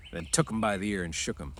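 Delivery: plain reading voice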